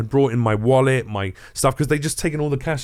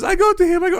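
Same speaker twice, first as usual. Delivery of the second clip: mock crying; 4 dB louder, faster than usual. Man imitating a girl crying over her boyfriend, mock wailing. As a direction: wail it like a crying girl, exaggerated. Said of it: "some girl starts crying her eyes out".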